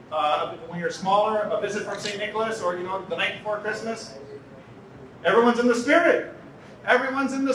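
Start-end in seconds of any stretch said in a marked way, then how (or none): none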